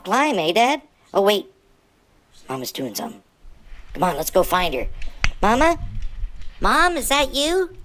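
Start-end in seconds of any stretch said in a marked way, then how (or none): none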